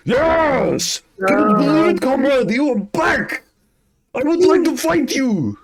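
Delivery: very high, loud eager warrior; Drake. Acted. Drake greets his rival from the back, shouting high and eager. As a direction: shout with battle-hungry delight. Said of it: "he's like".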